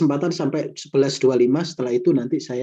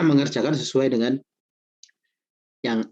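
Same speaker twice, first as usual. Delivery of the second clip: stuffy nose from a cold; talking through a head cold; sniffling. Real, not acted. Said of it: "ini saya pilek banget".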